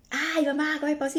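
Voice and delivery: a light imitation of blowing up at mother; sudden snap. Someone suddenly exploding at their mom, mock exasperated. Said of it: "Y ¡pum!, salió".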